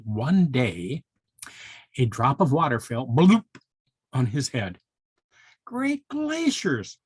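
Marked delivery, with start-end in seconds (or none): 5.6-7.0 high, slow piping pika voice; whistled. A narrator's reading. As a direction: squeak it out slowly like a tiny pika. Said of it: "whistled Peter Pika".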